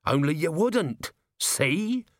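slow it, then lift high on the last word; manic. A narrator's reading. Commander finishes his baffling theory to a worried officer, manic. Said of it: "manic grin".

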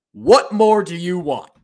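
slow, loud, high-pitched plea; exasperated demand. Real, not acted. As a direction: demand it loudly, stretching each word. Exasperated demand to absent coach.

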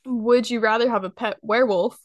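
normal talking voice

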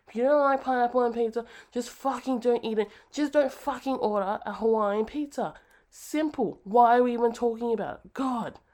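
loud, high, fast exasperated rant; angry rant. Real, not acted. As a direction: let it all out, fed up. Rant about pineapple pizza.

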